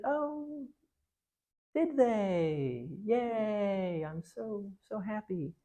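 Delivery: high, sugary, insincere witch voice; fake delight. The lying queen pretending to be glad, sugary. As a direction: lie with a sweet smile. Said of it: "said the liar".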